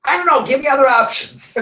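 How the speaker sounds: high, loud, laughing; laughing high plea. Retold or imitated. Old client laughs, asking the teacher for options. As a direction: throw up hands with a laugh.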